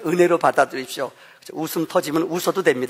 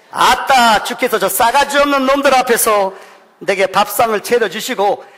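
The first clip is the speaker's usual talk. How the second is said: Psalm 23 in Jeolla dialect, higher and louder; 4 semitones higher, 4 dB louder than usual. Pretend. Pastor reading psalm to congregation in Jeolla dialect, higher and louder. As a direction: preach it like a proud Jeolla elder. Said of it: "마지막 전라도면".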